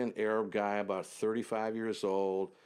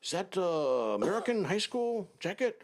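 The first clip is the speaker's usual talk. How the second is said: high, delighted squeal; excited sheik; 10 semitones higher than usual. Retold or imitated. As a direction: gush over the jacket, much higher. Sheik excited over the jacket.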